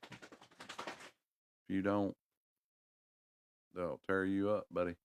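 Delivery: slow, stretched-out mock threat on if you don't; half-joking slow warning; drawn-out warning. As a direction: drag it out like a playful warning to a buddy. Mock warning about untrained dogs.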